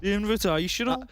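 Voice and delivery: attempted Birmingham accent, lightly done; a caster trying a Brummie Peaky Blinders twang. Caster to co-caster, a tentative Birmingham Peaky Blinders accent. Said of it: "trying to do a Peaky Blinders-esque accent".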